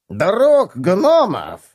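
high slow sneer on гномов; mock the idea with a snort; scoffing. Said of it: "фыркнул бронелом".

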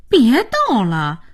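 dismissive, incredulous retort; incredulous scoff. Acted. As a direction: scoff as if it's a joke. Scoffs at the news.

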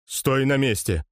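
ordinary delivery, nothing marked

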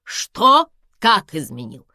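high, loud outraged questions; indignant. A narrator's reading. Karina rejects talk of cheating, indignant. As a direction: protest hotly, voice leaping up. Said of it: "возмутилась Карина".

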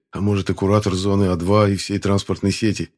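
ordinary delivery, nothing marked

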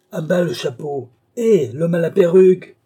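high, loud heckling call; crowd heckle. A narrator's reading. Onlooker heckling the man in the wig, shouting. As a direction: shout over the crowd at him.

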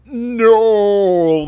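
sudden loud outburst; shouted no. Retold or imitated. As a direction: yell it in disbelief. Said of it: "make you go".

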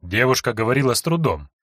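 usual speaking voice

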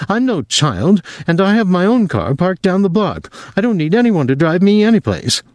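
put-on obsessive young woman. A narrator's reading. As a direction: she clings to him, urgent and possessive. Virginia insists defiantly.

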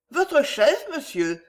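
a quick-spirited countess asking in surprise; lively. A narrator's reading. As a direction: pitch it up briskly on the question. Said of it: "fit la comtesse vivement".

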